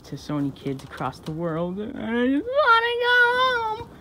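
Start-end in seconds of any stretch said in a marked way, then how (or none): 1.8-3.9 high fake sob, drawn out slow; hammed-up tearful Santa; mock crying. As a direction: whine it like a sulking child. Santa pleading for gifts.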